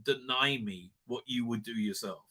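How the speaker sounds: slow, soft, low challenge; calmly pin him with his own logic; hushed, deliberate rebuke; quiet defiance. Retold or imitated. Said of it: "his daughter's like".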